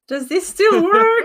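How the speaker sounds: high, drawn-out, half-laughing question; hopeful dancer. Acted. Player's character flossing for his friends, high slow hopeful question. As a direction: ask it slowly and high, showing off. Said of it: "being like".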